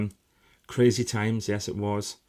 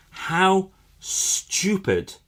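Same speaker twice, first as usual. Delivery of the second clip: exasperated; 9 semitones higher, 4 dB louder, much slower than usual. Real, not acted. Exasperated at moon deniers.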